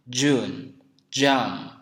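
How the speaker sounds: "June" and "jam" with voiced English j; two model words, set apart; English j demo. Shown as a demonstration. Teacher giving examples of j, careful "June, jam". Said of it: "tapi J".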